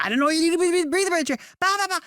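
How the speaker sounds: high-pitched mock brother squabbling; loud sibling bickering. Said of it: "and we're loud".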